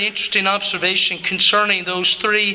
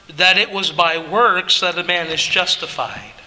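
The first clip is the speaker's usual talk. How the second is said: ordinary talk, nothing marked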